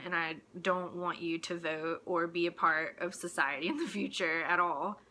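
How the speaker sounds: ordinary talk, nothing marked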